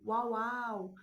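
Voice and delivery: uau, uau imitando um cachorro, mais forte; latido imitado. Retold or imitated. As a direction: late como um bebê imitando o cachorro. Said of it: "o cachorro". (imitando o latido de bebê)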